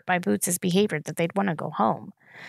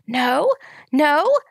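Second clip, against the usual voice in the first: higher and louder with each no; rising toddler protest; 9 semitones higher, 7 dB louder, much slower than usual. A narrator's reading. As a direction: build from a whine to a wail. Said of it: "her voice rising in pitch and intensity".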